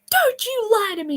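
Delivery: high-pitched mock scream; imitated screaming. Teacher accusing camper of lying, imitated screaming. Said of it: "she was screaming at me".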